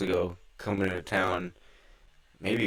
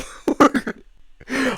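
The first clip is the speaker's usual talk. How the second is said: laugh; 4 dB louder than usual. Real, not acted. Laughing after the yell.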